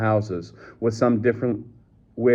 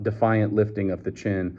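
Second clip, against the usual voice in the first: ordinary delivery, nothing marked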